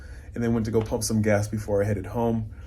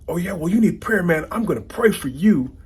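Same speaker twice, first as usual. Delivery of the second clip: high, louder mock threat to pray for him; mock yelling; 8 semitones higher, 6 dB louder than usual. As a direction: bark the prayer threat like a put-down. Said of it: "they're yelling back and forth".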